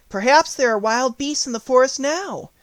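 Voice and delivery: put-on a worried little girl asking; Dorothy's voice. A narrator's reading.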